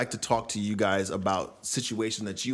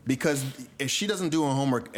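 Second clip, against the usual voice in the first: ordinary talk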